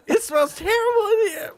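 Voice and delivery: very high, laughing through it; giggling squeaky cat lady; cat lady voice breaking into laughter. Acted. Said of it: "I am a crazy cat lady".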